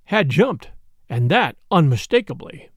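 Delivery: plain reading voice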